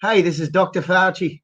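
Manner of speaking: shaky attempt at a New York accent; put-on New York voice. Shown as a demonstration. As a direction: try out a New Yorker's Fauci. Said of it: "Is he like a New Yorker?".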